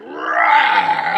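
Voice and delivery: loud roaring shout; lion roar. Acted. Furious lion roars at the rabbit. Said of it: "He went".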